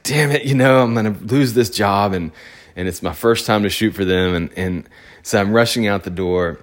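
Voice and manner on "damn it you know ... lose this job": higher, louder mock exasperation; imitating his own rushed panic; frustrated outburst. Racing to the shoot, retold frustrated outburst. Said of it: "and I'm just like".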